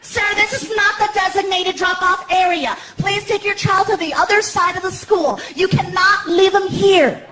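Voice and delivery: mimicked principal scolding; stern principal. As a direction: lay down the rules like a strict school official.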